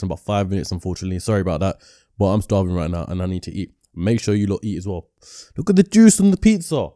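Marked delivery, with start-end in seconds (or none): 5.6-6.9 higher and louder, thrilled at the food; excited. Real, not acted. Host showing off his pizza, excited. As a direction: gush over the dripping pizza.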